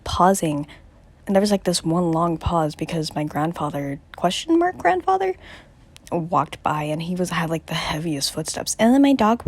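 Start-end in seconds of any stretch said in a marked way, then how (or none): 4.2-5.4 doubtful rising tone; questioning. Real, not acted. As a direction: say it as a question, unsure. Doubting it was grandfather.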